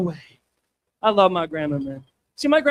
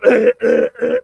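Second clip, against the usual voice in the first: gagging; 9 dB louder, slower than usual. Retold or imitated. Gagging while brushing teeth.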